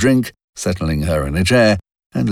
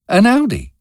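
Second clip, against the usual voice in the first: put-on a young woman's voice, clearly higher; Robin's higher voice. A narrator's reading. Robin defending her car to Wilcox, higher voice. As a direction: lift the pitch for Robin, a bit defensive.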